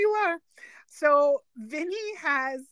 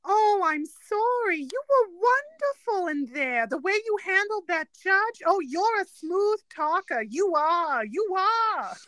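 slow, higher sarcastic Brooklyn praise; mock-sweet Mona Lisa; 4 semitones higher, slower than usual. Fiancee mocking Vinny's courtroom handling, sarcastic praise. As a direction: gush sarcastically, stretched out in Brooklyn. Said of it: "in that tone of voice with that intonation".

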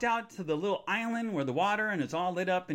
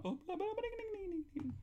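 soft, high little singsong noises; squeaky nonsense filler; playful babble; 10 dB quieter than usual. Pretend. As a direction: babble tiny and high to fill time. Filler noises while fixing chat.

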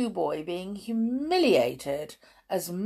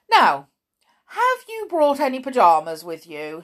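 loud, higher, brisk questioning; stern matron; 6 semitones higher, 7 dB louder than usual. A narrator's reading. Matron questions the new boy, stern. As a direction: demand it like a bossy matron.

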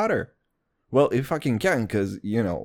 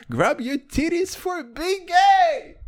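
slow, high, stretched-out holler; mock hype shout; 13 semitones higher, slower than usual. Quoting disrespectful rap lyrics, high hollered imitation. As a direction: belt it out high, dragging the last word.

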